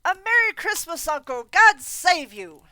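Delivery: loud, high, joyful greeting; cheerful cry. Acted. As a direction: burst in calling out cheerfully. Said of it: "cried a cheerful voice".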